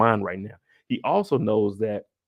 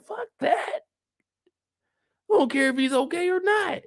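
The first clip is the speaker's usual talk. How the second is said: much higher, strained angry outburst; mock angry Charles. Charles rejects Lucille's concern, high strained mock outburst. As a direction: blow up like the fed-up dad.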